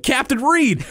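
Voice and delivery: high mock southern call; imitated southern lab coat man hailing the captain; southern accent. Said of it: "kind of southern accent".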